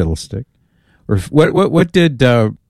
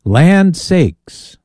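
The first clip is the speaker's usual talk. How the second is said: put-on southern accent; 8 semitones higher than usual. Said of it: "your voice getting southern sounding".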